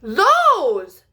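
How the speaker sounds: loud amazed gasped word; a touch of breathless awe. Acted. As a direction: gasp at the giant flowers. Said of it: "gasped Scurry".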